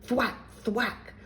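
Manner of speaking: playful, lightly yelled sound effect; mild mock yell. A narrator's reading. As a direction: strike with each word. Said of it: "yelled Zelda as she slashed".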